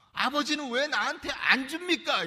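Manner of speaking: whining complaint. Retold or imitated. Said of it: "이렇게 하고 있는 거예요".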